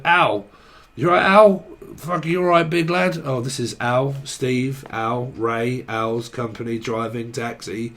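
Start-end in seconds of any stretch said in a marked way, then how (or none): 0.0-3.2 louder, higher pub greeting; Englishman in a bar. Acted. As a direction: hail a mate across the bar. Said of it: "an Englishman walks in at the bar and said".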